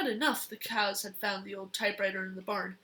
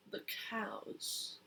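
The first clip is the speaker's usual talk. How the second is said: whisper; 11 dB quieter than usual. Acted. Child reads note's signature whispering.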